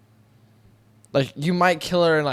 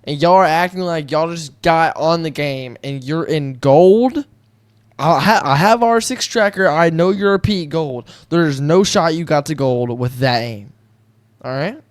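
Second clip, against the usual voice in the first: indignant call-out; 2 semitones higher, 6 dB louder than usual. Pretend. Player ranting at fake gold players in his lobbies, indignant call-out. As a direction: call out the opponents with force.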